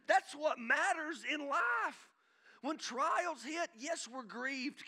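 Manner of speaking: escalating pulpit proclamation; building preaching. Acted. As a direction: drive each line home to the church. Preaching at full force.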